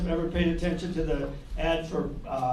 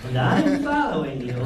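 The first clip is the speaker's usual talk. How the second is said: freaky guy; 6 semitones lower, slower than usual. Acted. Freaky guy answering a girl's Twitter post, creepy voice. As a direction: play a creepy online follower. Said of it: "some freaky guy's like".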